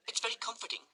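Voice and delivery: high, prim lady's voice; matron voice. Acted. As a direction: sip and sigh contentedly.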